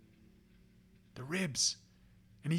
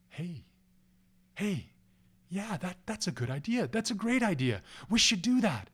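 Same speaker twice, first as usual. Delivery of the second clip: dawning agreement; 2 semitones higher than usual. Acted. Hunters warm to the idea.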